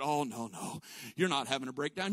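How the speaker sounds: low, soft, imitated pastor's comforting; calm her down quietly, voice dropped; soothing reassurance. Pastor soothes crying woman.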